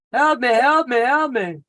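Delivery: a high, pleading "help me" said over and over; mock plea for help. Imitating Sherry's cry for help, pretending to be stuck at Walmart. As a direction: play a damsel in distress. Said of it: "um, Sherry?".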